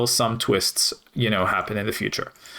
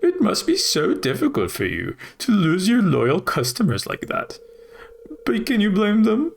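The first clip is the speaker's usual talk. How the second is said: high, slow gloating British voice; mocking British corporate villain; smug British Morris; 8 semitones higher than usual. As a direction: rub it in to the rival shopkeeper, British. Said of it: "why I made him British".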